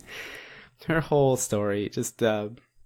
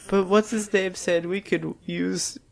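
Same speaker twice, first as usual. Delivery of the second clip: put-on high earnest frugal girl; 7 semitones higher than usual. Anzu's frugal remark.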